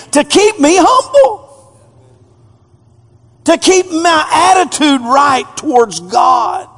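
high, loud preacher shout; shouted climax. Acted. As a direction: cry it out to the rafters. Paul quoted, climax shout.

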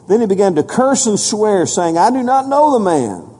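loud, high preacher's reading of scripture; preaching scripture. Acted. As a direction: proclaim the verses to the whole church. Reading Peter's denial aloud.

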